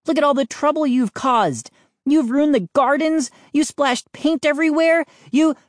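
high, indignant tirade; demanding accusation. A narrator's reading. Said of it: "finally finding my voice".

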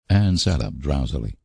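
usual speaking voice

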